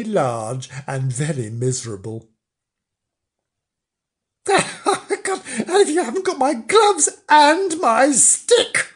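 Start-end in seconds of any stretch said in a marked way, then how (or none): 4.5-8.9 high-pitched, indignant outburst; indignant old-fashioned storybook outrage; outraged cry. Acted. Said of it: "cried Mister Flange".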